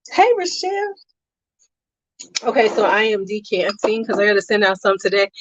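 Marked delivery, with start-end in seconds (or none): 0.1-0.9 high greeting. Real, not acted. Streamer welcoming Rochelle, high sing-song.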